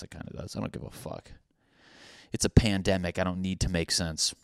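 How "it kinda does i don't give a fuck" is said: whispered under breath; whispered aside. Real, not acted. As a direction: mutter it under your breath. Muttered aside.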